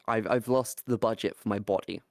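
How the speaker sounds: ordinary delivery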